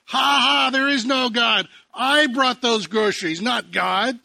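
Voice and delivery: loud, high mocking laugh and jeer; gloating taunt. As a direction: jump out and gloat in triumph. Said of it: "jumps out and says".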